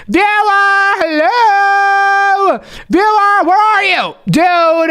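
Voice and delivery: high, drawn-out searching call. Acted. Host searching for the missing viewer, calling out. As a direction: call for someone out of sight.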